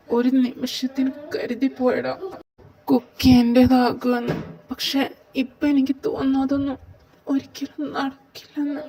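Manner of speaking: heartbroken confession, pitch lifted; acted tearfulness. Confiding a lost love to a friend, tearful. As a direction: confess lost hope on the edge of tears. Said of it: "നീ എന്തിനാ കരയുന്നേ?".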